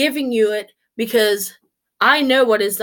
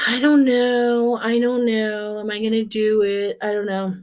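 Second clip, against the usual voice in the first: doubtful inner voice. Retold or imitated. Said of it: "we all have that".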